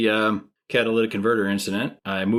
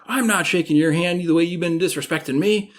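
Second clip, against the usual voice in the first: offended refusal; 8 semitones higher, 3 dB louder than usual. Retold or imitated. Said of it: "He's like".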